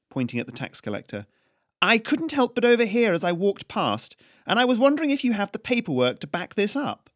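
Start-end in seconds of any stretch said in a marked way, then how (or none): 1.8-7.1 higher, louder, crisp noble lady; cool aristocratic challenge; imperious noblewoman Haryana. Acted. Noblewoman Haryana challenges the tax collector, imperious and crisp.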